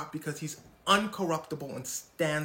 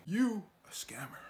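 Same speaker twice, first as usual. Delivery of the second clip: whispered accusation. Retold or imitated. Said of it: "The singer's like".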